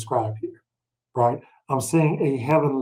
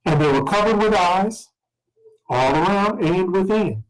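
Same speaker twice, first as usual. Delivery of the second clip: measured scripture reading voice; 3 semitones higher than usual. Acted. Teacher reading Revelation aloud, measured scripture voice. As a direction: read the verses out to the class.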